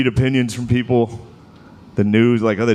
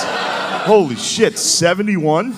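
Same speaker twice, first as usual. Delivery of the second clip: mock disbelief; 7 semitones higher, much slower than usual. Real, not acted.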